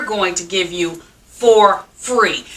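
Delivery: free slowed and leaned on; "free" detto lentamente e con forza, marcato; stress on free. Real, not acted. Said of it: "I have to emphasize that word free".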